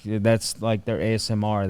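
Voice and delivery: usual speaking voice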